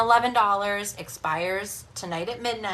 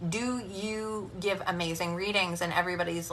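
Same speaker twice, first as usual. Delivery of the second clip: usual speaking voice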